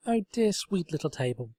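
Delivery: sincere, eager explaining; Twilight voice. A narrator's reading. Twilight tender.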